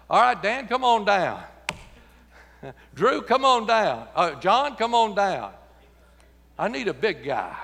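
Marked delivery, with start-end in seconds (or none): 0.1-5.5 calling out names. Real, not acted. Calling men up front.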